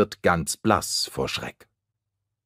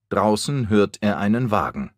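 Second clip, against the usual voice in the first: usual speaking voice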